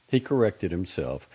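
ordinary delivery, nothing marked

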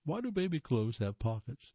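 ordinary talk, nothing marked

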